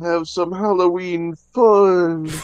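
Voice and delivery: high, slow whimpering mock fear; mock scared. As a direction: pretend to be a frightened kid. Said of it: "I'm scared".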